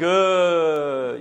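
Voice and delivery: loud, long-drawn scoffing "uh"; big hammed-up jeering groan; scoffer's jeer. Preacher mimicking scoffers at church, loud jeering. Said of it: "Scoffers are like".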